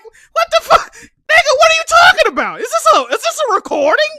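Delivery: high, loud disbelieving shout; shocked outburst. Retold or imitated. Streamer retelling his shocked reply, high disbelieving shout. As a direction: shout it like you can't believe it. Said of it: "I'm like".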